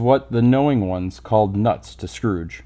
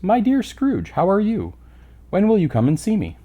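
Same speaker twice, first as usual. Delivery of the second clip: bright, much higher friendly voice; cheery greeting; 9 semitones higher than usual. Acted. Passerby hailing Scrooge, high and cheery. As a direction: sing out a hearty hello.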